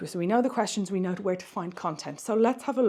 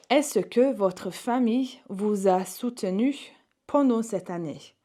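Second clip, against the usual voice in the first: slow model reading; 2 semitones higher than usual. Shown as a demonstration. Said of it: "slow model repetition".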